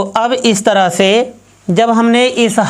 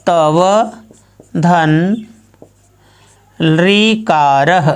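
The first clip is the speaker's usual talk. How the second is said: slow, careful लृकारः with every sound drawn out; slow dictation; 2 semitones lower, much slower than usual. Shown as a demonstration. Teacher sounds out लृकारः slowly while writing it. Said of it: "तो अब यहाँ पर हम कर देते हैं".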